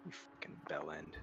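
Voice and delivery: whisper. Real, not acted. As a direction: breathe the insult under your breath. Muttered insult at a friend.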